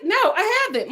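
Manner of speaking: high, taken-aback little answer; reenacting a startled denial; high-pitched mock innocence; retold shocked reply.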